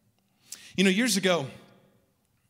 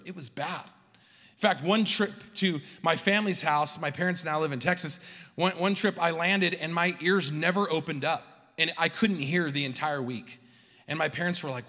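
ordinary delivery

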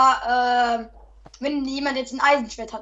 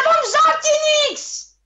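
angry complaining; 10 semitones higher than usual. Real, not acted. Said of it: "hör auf, so rumzurasten".

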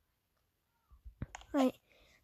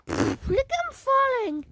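amused; 9 semitones higher, 4 dB louder, slower than usual. Real, not acted. Laughing at the killer falling.

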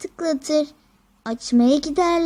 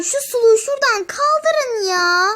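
whiny crying; 6 semitones higher, 6 dB louder than usual. Acted. Child hurt, whining.

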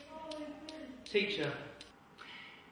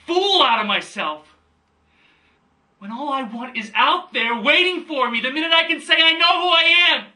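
building from firm to a high, loud cry; impassioned outburst; 7 semitones higher, 6 dB louder than usual. Acted. Son telling his father all he wants is waiting, rising to a shout. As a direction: swell steadily to a shouted declaration.

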